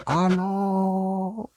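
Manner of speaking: a long, high holler into the hills; high valley call. Shown as a demonstration. Preacher shows a shout in the valleys, high hailing call.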